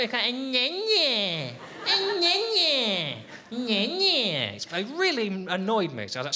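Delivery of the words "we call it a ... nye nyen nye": high, slow, whining "nye-nye"; mocking nye-nye. Shown as a demonstration. Comic ridiculing how onion sounds, "nye-nye" wailed high and long. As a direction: stretch "nye-nye" into a childish high whine. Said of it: "that's not what we call it".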